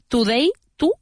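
"today" mispronounced as too-day, "tú" rising; wrong full U. Shown as a demonstration. Teacher showing the mistake to avoid, "today" with a "tú" sound. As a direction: give "today" a full Spanish u.

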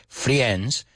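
"friends" with the i wrongly voiced, "fri-ends"; mispronounced friends. Teacher quoting the mistake heard constantly, "friends" as "fri-ends". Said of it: "Oigo eso mil millones de veces".

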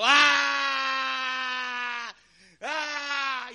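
high wild scream of a possessed man; madman scream. Acted. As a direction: shriek like the madman. Demoniac screaming in cemetery. (grito de endemoniado)

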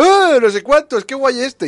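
mock hyped, high and loud; excited. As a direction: ham up slow, gushing excitement. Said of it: "tú antes has estado diciendo".

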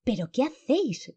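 high, alarmed cry; grito furioso y asustado. A narrator's reading. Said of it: "gritó Bastian". (grito agudo de alarma)

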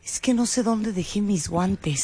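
soft, slow lost excuse; meek excuse. Retold or imitated. Charo tells her friend about her gloves, soft and slow. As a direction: mumble it like a lost kid.

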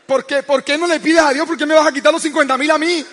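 high, loud, fast mock outrage; indignant wife. Wife protests he takes her money, hammed-up outrage. Wife's angry protest retold.

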